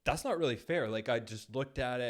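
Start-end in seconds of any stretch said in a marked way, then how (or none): 0.0-0.9 higher, louder, a touch of irritation; mild mock protest, pitched up; pissed off. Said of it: "a little more, like, pissed off".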